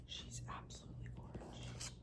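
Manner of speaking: whispered admiration; awed whisper. Real, not acted. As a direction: whisper it, barely audible, in awe. Awed whisper while unboxing laptop.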